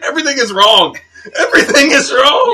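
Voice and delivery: loud, rising wail; mock dismay. Viewer after watching one episode of Arrow, mock despair. Acting out own outraged reaction.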